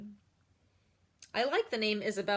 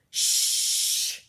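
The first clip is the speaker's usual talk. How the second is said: sharp unvoiced shush; hushing shh; 7 dB louder than usual. Lady in front hushing the girl, sharp shush. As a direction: hush her from the row ahead. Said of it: "The lady says from in front".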